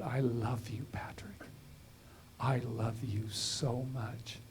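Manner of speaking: soft, slow whispered self-love; speaking love to himself, hushed and slow; tender whisper to self. Retold or imitated. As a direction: whisper it slowly, tenderly, to yourself. Telling himself he loves himself.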